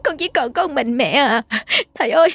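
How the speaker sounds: high, tearful begging; choked pleading. A narrator's reading. Girl begging the healer to save her mother, choked high plea. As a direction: plead through tears. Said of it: "giọng nghẹn ngào".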